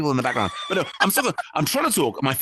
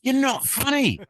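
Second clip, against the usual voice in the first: high-pitched scolding nan; 8 semitones higher than usual. Retold or imitated. Grandmother tells comedian grandson to quit, mock high scold. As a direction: mimic a grandmother telling him off.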